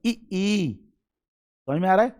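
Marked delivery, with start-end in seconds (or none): 0.1-0.8 ई, ई said from the front of the tongue, short then long; front vowel. Shown as a demonstration. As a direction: place both ई right at the front. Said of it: "बिल्कुल just आगे से बोल रहा". (ई, ई जीभ के आगे से)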